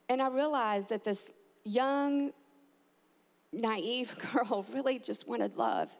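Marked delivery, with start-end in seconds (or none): none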